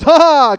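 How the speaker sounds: loud, high mock guffaw; imitated laugh. Neighbor laughing at the sofa standoff, loud mock laugh. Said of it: "And Oscar laughs".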